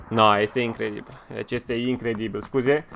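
ordinary talk, nothing marked

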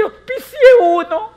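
a proud newcomer yelling the news; excited boast. Retold or imitated. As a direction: shout it out half laughing. Newcomer reports first sponsorship.

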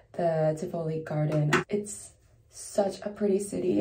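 usual speaking voice

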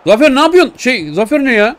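high, loud shout at the keeper; high-pitched loud scolding; exasperated yell. Real, not acted. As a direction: yell at him for the blunder. Gamer yelling at goalkeeper.

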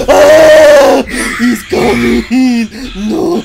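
very loud, high terrified yelling; screaming as the monster closes in; panicked scream. Real, not acted. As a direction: yell in real fright as he flees. Monster chasing him in game.

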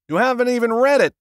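high, fast, loud outburst; shocked disbelief, blurted; aghast. A narrator's reading. Author aghast at the agent's refusal.

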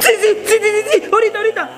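high-pitched frantic agreement; panicked compliance. Acted. Child threatened by mamá agrees to eat, panicked. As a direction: give in at once, alarmed.

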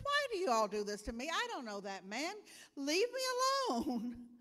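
fed up and cornered; exasperated outburst. Acted. Peter snaps at accusers, loud and fast.

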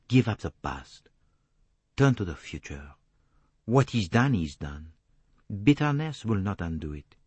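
put-on grave accented detective counsel; measured advice in short, weighted phrases; Poirot, grave. Detective urges her to let go, grave accented voice.

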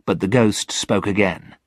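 ordinary delivery, nothing marked

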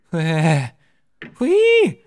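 long slow sigh rising to a high squeaky whoosh; hammed-up sigh of relief; relieved exhale. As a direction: blow out in relief, hamming it up. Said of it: "やっと息をついた".

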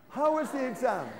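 a brighter, keener ask of how the exam went. Shown as a demonstration. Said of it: "a little, little bit more Ganas".

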